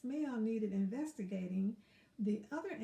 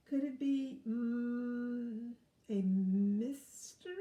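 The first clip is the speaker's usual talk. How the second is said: raccoon detective wondering; 5 dB louder, slower than usual. Acted. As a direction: wonder aloud, drawing out the mystery. Raccoon voiced in story.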